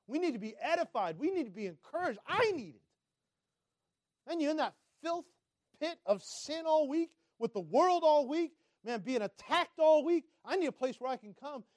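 high, insistent sermon, rising in force; impassioned preaching. Acted. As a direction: plead it fervently from the pulpit.

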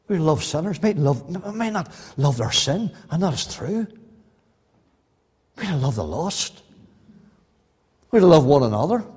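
normal talking voice

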